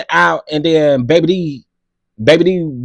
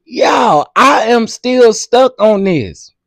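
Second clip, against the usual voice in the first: slow and higher, stretched; drawn-out disbelief; 6 semitones higher, 3 dB louder, much slower than usual. Real, not acted. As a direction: drag it out, still stuck. Reacting, still in disbelief.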